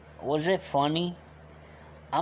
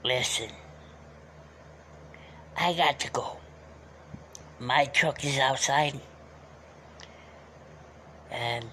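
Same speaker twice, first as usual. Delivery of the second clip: put-on old Uncle Alfonso voice; 3 semitones lower, 5 dB quieter than usual. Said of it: "I can't talk like that".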